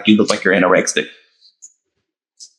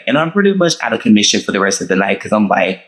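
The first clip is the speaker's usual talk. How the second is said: ordinary talk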